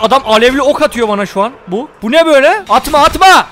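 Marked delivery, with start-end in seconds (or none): 2.0-3.5 high, loud cry under attack; urgent raised-voice protest; alarmed protest. Real, not acted. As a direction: yell at the attacker to stop. Being shot with flaming arrows.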